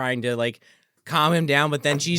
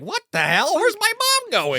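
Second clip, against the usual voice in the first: rising bewildered "where's my mom going", pitched much higher; mock alarm, climbing high; confused son. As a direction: play the baffled son tailing his mom. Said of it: "He's like".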